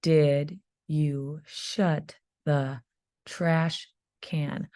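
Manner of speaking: each word drawn out very slowly, x0.5; teacher dictating a sentence to first graders; slow dictation. Shown as a demonstration. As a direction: dictate it slowly so kids can write. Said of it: "listen one more time".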